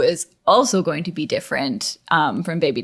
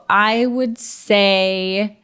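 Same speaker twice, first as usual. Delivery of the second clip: normal talking voice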